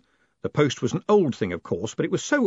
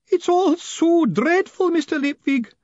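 high, slow, melodramatic wail; hammed-up woman's lament, very high and loud; despairing; 5 dB louder, slower than usual. A narrator's reading. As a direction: wring every drop of misery from it. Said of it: "drain the bitter cup of despair".

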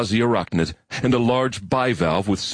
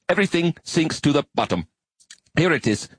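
higher, earnest insect-like alien voice; high alien arachnid; 9 semitones higher than usual. Acted. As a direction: play an eager, bookish spider creature.

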